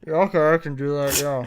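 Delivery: burnt-out fast food worker voice; drive thru speaker. Acted. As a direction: talk like a bored drive-thru employee. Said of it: "why don't you do that this whole episode".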